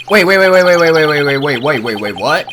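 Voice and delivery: loud, rapid panicked string of waits fading into confusion; frantic stop-stop-stop, then puzzled; alarmed wait. Real, not acted. Player panicking at an unexpected button prompt, loud and alarmed. Sudden alarm at game event.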